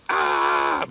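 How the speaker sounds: loud, low bellow of a bucking horse; full-on bucking bellow. Retold or imitated. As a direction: imitate a horse exploding into bucking. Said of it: "Al goes into full on".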